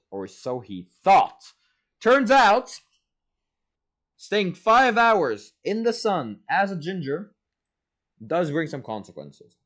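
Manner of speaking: hammed-up storybook narrator foreshadowing; dramatic narrator. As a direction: announce the doom like a movie trailer. Dramatic third-person narrator turn.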